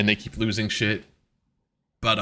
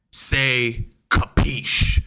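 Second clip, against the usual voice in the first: very slow, drawn-out order; mock commanding; much slower than usual. Odd leader making teammates say it, mock commanding. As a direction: spell out the demand with long pauses. Retold leader demanding the word.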